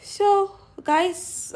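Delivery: ordinary talk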